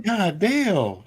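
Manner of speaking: high, exasperated exclamation. Retold or imitated. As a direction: blurt it out in disbelief. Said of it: "I'm like".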